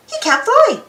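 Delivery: high, anxious cry; worried cry. Acted. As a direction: cry out in alarm for a friend. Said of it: "cried Quincy".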